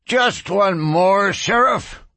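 high, slow, drawn-out plea; pleading. A narrator's reading. Thirsty drunken miner to the sheriff for another drink, pleading. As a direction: beg for it, pitched well up. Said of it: "pleaded thirsty Mick Tuft".

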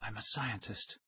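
very soft, whispery man's voice; thin whisper. A narrator's reading. Stranger reassuring captured kids, thin whisper. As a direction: speak in a thin, hushed voice. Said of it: "He had a thin whisper of a voice".

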